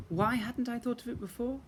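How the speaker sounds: a flash of alarm; urgent realisation. A narrator's reading. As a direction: realise it all at once. Narrator's urgent inner thought.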